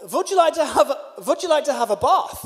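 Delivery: bad accent, W said as V; terrible put-on accent. Host offering guest a bath, terrible put-on accent. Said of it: "sorry for the terrible accent".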